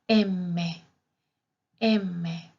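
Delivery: "M" as emme, holding the double m; double consonant. Shown as a demonstration. Teacher demonstrating Italian M twice, deliberate double-consonant demo. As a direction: say "M" in two beats, em-me. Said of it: "se pronuncia con doble consonante".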